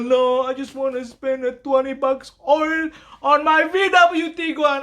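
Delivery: high, whiny mock customer. Mechanic mocking a stingy customer, whiny high voice.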